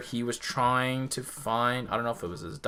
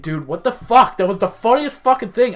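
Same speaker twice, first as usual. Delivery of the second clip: excited retelling; 7 semitones higher, 8 dB louder than usual. Retold or imitated. Retold friend raving after movie.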